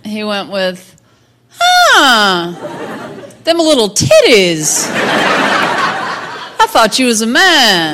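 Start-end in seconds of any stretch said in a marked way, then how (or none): 1.5-7.9 mock astonishment. Comic quotes a startled onlooker, mock astonishment. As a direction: stretch it out in hammed-up disbelief. Acting out a man's astonished reaction.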